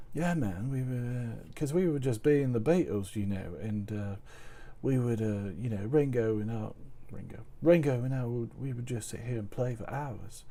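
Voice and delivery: soft Liverpool musician impression. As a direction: murmur it quietly like an English rock star reminiscing. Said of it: "we can do some [name]".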